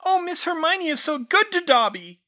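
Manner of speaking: high, loud overjoyed elf squeak. Acted. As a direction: squeal out your gratitude like a tiny elf. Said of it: "he squeaked".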